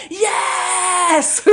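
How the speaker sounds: high, loud shouted yes; a room of kids yelling yes to the puppet show, high and loud; kids cheering yes. Retold or imitated. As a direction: shout it like a whole class cheering. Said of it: "And they're all like".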